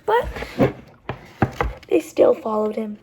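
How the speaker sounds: usual speaking voice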